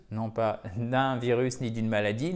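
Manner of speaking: usual speaking voice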